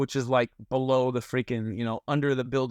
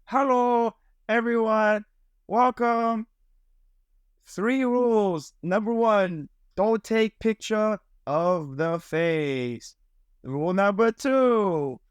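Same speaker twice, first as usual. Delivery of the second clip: imitating the maid; 7 semitones higher, much slower than usual. Maid explains cafe rules to guests, high and slow. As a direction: mimic the maid spelling out rules, pitched well up. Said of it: "It's exactly how she sounds".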